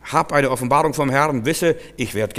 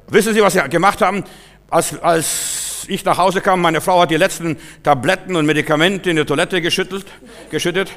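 imitated indignant caller, voice raised; appalled and angry; 5 semitones higher, 5 dB louder than usual. Husband reports the discarded tablets, appalled and angry. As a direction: accuse as an outraged husband would. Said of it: "ganz entsetzt und böse".

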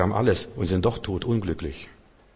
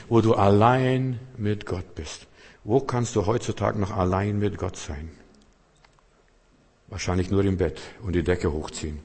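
normal talking voice

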